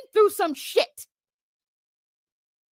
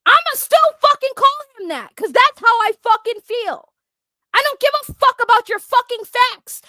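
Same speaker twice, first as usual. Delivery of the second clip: furious, much higher and louder rant; angry shouting; 5 semitones higher, 5 dB louder than usual. Real, not acted. Ranting at his critics on the platform, furious shout. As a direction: blast it out in real anger. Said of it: "I'm angry".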